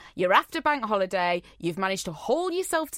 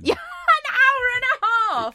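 high, drawn-out incredulous cry; incredulous squeal; 13 semitones higher, 4 dB louder than usual. Real, not acted. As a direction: squeal it in disbelief, stretched out. Incredulous at the long wait.

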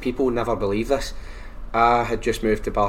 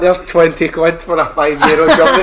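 higher and louder, laughing through it; laughing mock quote; 6 semitones higher, 9 dB louder than usual.

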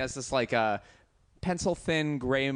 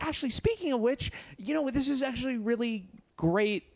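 much higher, bubbly eager voice; eager tourist; 10 semitones higher than usual. Retold or imitated. Telling the Japanese stranger his plans, excitedly eager. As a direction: gush with sudden excitement. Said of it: "And I was like".

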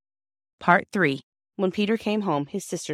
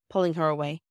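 ordinary delivery, nothing marked

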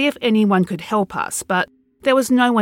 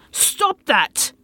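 higher, sharp order to stop; command; 6 semitones higher than usual. Acted. Man orders barking masked kids to stop, sharp command. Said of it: "I commanded".

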